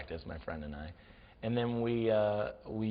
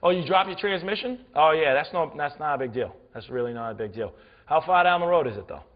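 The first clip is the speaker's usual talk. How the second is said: loud, higher-pitched New Englander; breezy New England local, brushing it off; New England accent; 3 semitones higher, 5 dB louder than usual. Retold or imitated. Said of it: "had the best accent ever".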